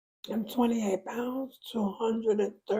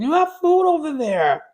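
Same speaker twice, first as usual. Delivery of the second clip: raised, firm scolding of a dog; 6 semitones higher, 8 dB louder than usual. Real, not acted.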